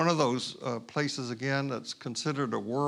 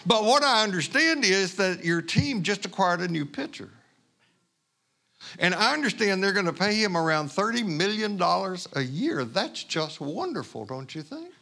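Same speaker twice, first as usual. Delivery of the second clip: higher, louder mock-admiring irony; ironic stranger; 5 semitones higher, 3 dB louder than usual. The stranger mocks the pitcher's salary to the preacher, higher and louder. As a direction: hammer the irony about the money.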